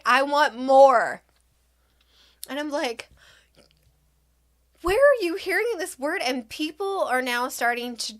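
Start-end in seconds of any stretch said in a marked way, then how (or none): 0.1-1.2 put-on loud, drawn-out toddler demand; mock yell, stretching the words; imitating screaming child. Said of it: "she screamed this word over and over". 4.8-6.2 high, quick retold outburst; mortified disbelief. Mortified mother questions her child.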